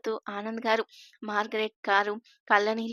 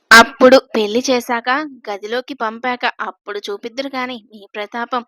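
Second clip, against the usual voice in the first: bursts out much louder, teasing the groom; loud playful teasing; 13 dB louder than usual. A narrator's reading. Sister-in-law teasing the groom loudly, playful. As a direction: tease him loudly, about to laugh. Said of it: "నవ్వేసింది Akanksha".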